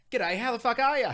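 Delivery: loud, high, broad Australian greeting; loud, high-pitched Australian twang hollered across a driveway; brash Aussie greeting. Retold or imitated. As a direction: belt it out like a cheeky Australian local. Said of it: "And she was like".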